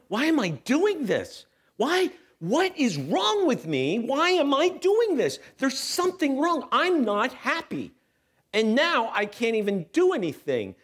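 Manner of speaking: strained cry to God; anguished prayer. Retold or imitated. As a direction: plead upward in retold despair. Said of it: "I shout this silent prayer".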